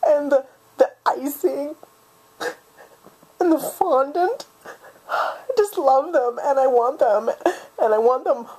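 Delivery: weepy, halting list with sobbing breaths; choked up with longing; tearful. Acted. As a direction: fight back tears between phrases. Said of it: "I promised myself I wouldn't cry".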